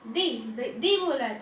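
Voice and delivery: usual speaking voice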